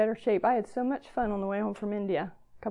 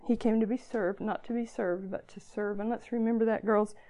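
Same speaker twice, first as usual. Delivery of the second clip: ordinary talk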